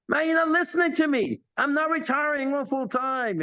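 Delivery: put-on high, exasperated young man; pleading to be heard by his mentor; frustrated insistence.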